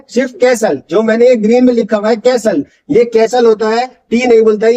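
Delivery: ordinary talk, nothing marked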